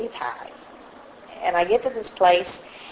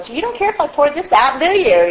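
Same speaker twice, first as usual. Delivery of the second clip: retold question, pitched up and rising in volume; mock-innocent asking; 7 semitones higher, 4 dB louder than usual. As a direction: imitate her in a higher, lilting voice. Woman in kitchen pouring out wine.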